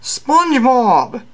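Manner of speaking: high, slow dopey Hulk. Acted. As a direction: drag the words out lazily.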